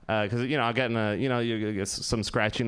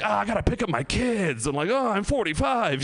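whiny midlife adult; 7 semitones higher than usual. Retold or imitated.

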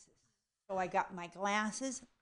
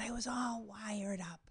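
breathy whisper to build suspense; 9 dB quieter than usual. Acted. Whispering to the audience about being wired up. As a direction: hush it like a secret.

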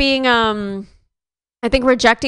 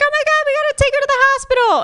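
very high, fast frantic squeal; hammed-up parental panic; mock panicked parents; faster than usual. Parents rushing grandma to hospital, high frantic squeal. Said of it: "my parents were like".

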